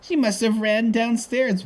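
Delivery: put-on high, slow old lady voice; old lady character. Voicing old Missus Bigsley.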